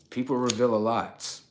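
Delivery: normal talking voice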